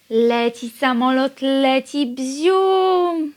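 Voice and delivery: playful airplane game. Acted.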